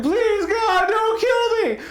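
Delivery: high, crying shout for mercy; terrified begging. Pretend. Victim begging the killer not to shoot, shrieking high. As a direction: shriek a desperate plea, near tears. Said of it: "He's like".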